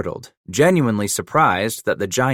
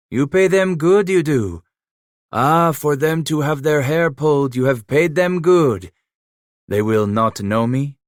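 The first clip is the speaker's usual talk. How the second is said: put-on teasing, a bit higher and louder, French-accented; French-accented Emile, mocking; 6 semitones higher, 5 dB louder than usual. A narrator's reading. Trapper Emile mocking Leclerc's runners, lightly French-accented. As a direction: needle him, half laughing in a French accent.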